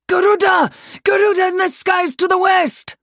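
high, loud cry of warning; panicked lookout's shout; screamed alarm. Acted. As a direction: scream the alarm across the army. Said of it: "someone screamed".

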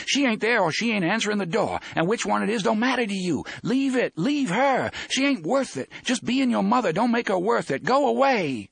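high, urgent pleading shout. A narrator's reading. Susanna begs Roland to leave his mother, shouting. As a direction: drive him away from the door, high and insistent. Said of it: "Susanna shouts at him".

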